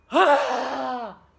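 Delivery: big dramatic gasp; sharp startled intake of breath; loud gasp. A narrator's reading. Sound effect in story.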